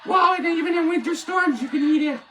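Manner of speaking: high silly pitchman voice; mock ad pitch. Vlogger hyping glowing lasagna, high mock ad voice. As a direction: sell it like a goofy commercial.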